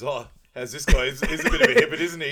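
loud, high mock bloke at a party; mock partygoer. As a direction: call it out like a guy at a party. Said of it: "imagine going on a night out and someone goes".

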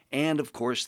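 usual speaking voice

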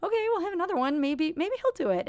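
mock-cheery thought about having another baby; hopeful inner voice. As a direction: convince yourself with a shrug. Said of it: "you kind of think to yourself".

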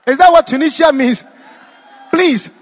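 real amused disbelief; shouting to the crowd in amusement. Real, not acted. Laughing at mistranslation.

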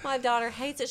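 normal talking voice

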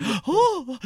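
high-pitched mock gasp; imitating a swooning, high "oh"; imitated flustered gasp. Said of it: "she's all flustered".

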